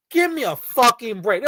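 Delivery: usual speaking voice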